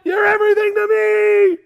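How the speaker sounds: very high, loud hammed-up fan scream; mock adoring yell. Fan screaming devotion to the pitcher, hammed-up. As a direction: gush at the top of your lungs. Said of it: "Did you yell".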